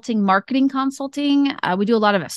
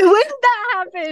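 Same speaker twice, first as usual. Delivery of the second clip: hammed-up surprise, voice pitched way up; mock astonishment; 12 semitones higher than usual. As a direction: echo the amazement in fun. Playfully echoes the astonished quote.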